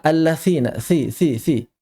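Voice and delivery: "allatsina, si, si, si" with unbuzzed th; misreading "allatsina" with hissing tongue-tip "si"; voiceless tha. Shown as a demonstration. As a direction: devoice the dhal into th in "allatsina". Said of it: "Kurang jahar".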